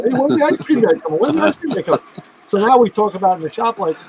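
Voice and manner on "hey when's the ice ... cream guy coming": a lightly voiced eager coworker. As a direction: call out like he can't wait for ice cream. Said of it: "he was, like, out there".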